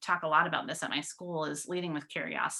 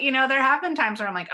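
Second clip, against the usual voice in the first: normal talking voice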